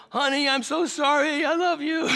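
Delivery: very high, loud mock-tearful plea; hammed-up apology. Husband phoning his wife to apologize, hammed-up. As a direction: overplay the sorry husband.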